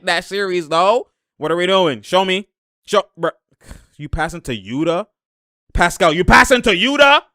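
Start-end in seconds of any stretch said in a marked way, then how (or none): 5.7-7.2 loud, high, exasperated question; incredulous shout. Real, not acted. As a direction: yell at the player in disbelief. Incredulous at a bad pass.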